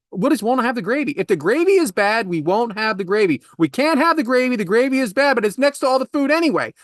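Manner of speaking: high-pitched indignant rant, rapid and relentless; worked-up comic tirade; exasperated retold argument. Retold argument with her over gravy.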